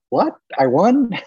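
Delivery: high, loud disbelief; surprised. Real, not acted. Hearing his name announced at the competition, loud shocked disbelief. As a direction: blurt it out, stunned he placed.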